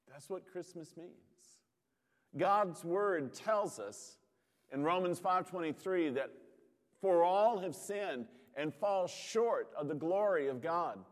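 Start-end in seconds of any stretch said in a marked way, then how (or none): 2.4-11.0 louder, driving preacher cadence; fervent preaching. Acted. Pastor stirs his congregation about God knowing grief, fervent preaching. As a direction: build it like a pulpit climax.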